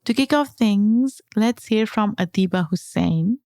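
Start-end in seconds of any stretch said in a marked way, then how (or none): none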